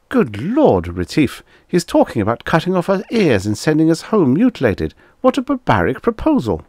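very high, fast, agitated protest; horrified. A narrator's reading. Magnan aghast to Retief at ears being cut, horrified. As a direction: squeak in alarm, pitched far up.

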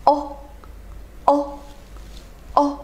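very short, crisp "哦"; short vowel demo. Shown as a demonstration. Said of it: "这个发音是非常的短促的". (短促圆唇地示范“哦”)

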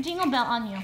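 normal talking voice

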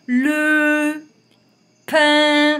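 high and loud, slow; loud teacher model reading; syllable reading. Shown as a demonstration. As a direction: sound out pain syllable by syllable. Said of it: "Donc ça fait coin".

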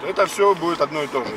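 ordinary talk

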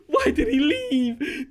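high, slow, whining cry; wailing lament. Real, not acted. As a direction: drag it out in mock grief. Player left server, despair.

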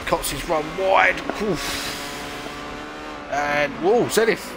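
rising pitch as cars spin; sudden alarmed commentary; excited race call. Real, not acted. Crash in race.

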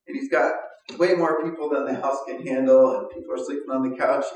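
ordinary talk

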